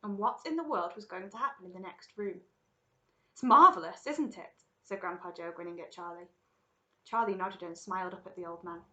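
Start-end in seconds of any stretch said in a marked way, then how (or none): 3.3-4.6 higher, loud burst of delight easing off. Acted. Grandpa Joe marvels to Charlie in the boat, delighted. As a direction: marvel aloud at it all.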